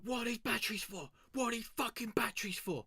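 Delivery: high, squealing imitation of a security guard; mock panicked guard. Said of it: "they were like".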